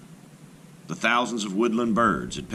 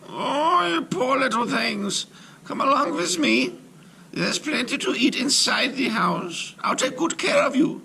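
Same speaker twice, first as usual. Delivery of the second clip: put-on high, honeyed old crone voice; sweet-talking witch. Witch coaxing the hungry children inside, high and sugary. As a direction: lure them in with false kindness. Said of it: "she wagged her head and said".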